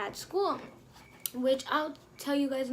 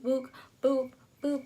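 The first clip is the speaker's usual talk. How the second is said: normal talking voice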